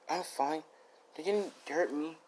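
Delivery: put-on little boy reassuring his uncle; small child voice. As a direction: play a brave little boy, lightly. Little Jack voiced in story.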